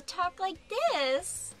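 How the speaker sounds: high, nasal princess talk; high-pitched princess voice. Shown as a demonstration. Mocking how princesses must talk, high and nasal. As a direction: pitch it up through the nose. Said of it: "through the nose and really high-pitched".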